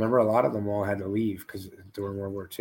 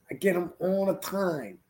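higher, slow, smug brag; imitating Scotty; 8 semitones higher, 4 dB quieter, slower than usual. Host mimicking his friend's boast, higher and slow. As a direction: drawl the brag smugly.

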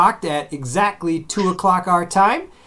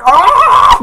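very high, loud startled shriek; amazed child scream; 11 dB louder than usual. Pretend. Toddler son seeing a new video game, high shriek. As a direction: shriek like a kid seeing magic. Son's mind blown by game.

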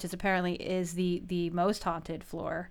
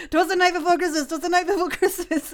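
Dickens recitation; 8 semitones higher, 7 dB louder than usual. Pretend. Summoning Dickens in mirror.

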